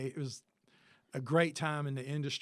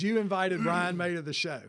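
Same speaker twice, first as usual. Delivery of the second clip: louder, higher disbelief; imitated friend's stunned, louder question; shocked question; 4 semitones higher, 9 dB louder than usual. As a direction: react as if your friend just did something outrageous. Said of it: "Like that".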